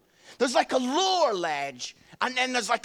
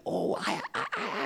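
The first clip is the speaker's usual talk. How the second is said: normal talking voice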